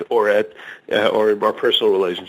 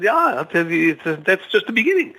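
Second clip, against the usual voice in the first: higher, eager farmer's boast; joke farmer voice; 7 semitones higher than usual. Acted. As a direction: lean in like the farmer with more to tell. Farmer character in told joke.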